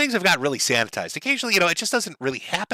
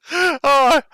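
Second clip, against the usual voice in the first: laughing; 10 semitones higher, 6 dB louder than usual. Real, not acted. Reactor overwhelmed by laughter.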